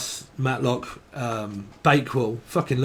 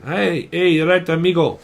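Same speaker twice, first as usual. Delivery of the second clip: lightly put-on Mexican accent, pitched up; playful mock-Mexican greeting; 4 semitones higher, 4 dB louder than usual. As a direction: call out with a hint of Mexican. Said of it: "From Mexican".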